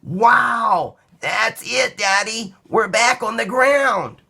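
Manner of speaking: put-on high-pitched child's delighted cry; excited child. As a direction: shout with joy as the wheels touch down. Child thrilled at landing.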